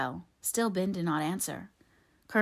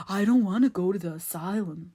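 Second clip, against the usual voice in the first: louder, pleading simple-minded voice; frightened simpleton Ben; 5 dB louder than usual. A narrator's reading. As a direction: plead like a scared simple man. Ben's simpleton voice.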